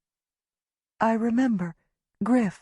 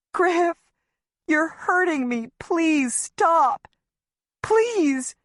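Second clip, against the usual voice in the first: high, pleading cries; frightened begging; 10 semitones higher, much slower than usual. A narrator's reading. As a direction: beg him to let go. Being dragged, begging.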